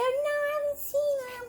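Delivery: high-pitched little toy answer; toy's voice. Pretend. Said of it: "She go".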